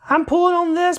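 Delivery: straining with effort. Real, not acted. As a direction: say it while pulling hard. Pulling hard on a stuck part.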